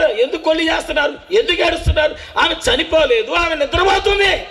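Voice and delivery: high, loud preacher's cry; full-force preaching. Acted. Preacher retelling Jesus to the congregation, shouting. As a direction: preach it out to the crowd.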